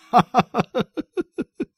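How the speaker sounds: very high cackling ha-ha run; long laugh trailing off; father roaring at his own tease; hearty acted laugh.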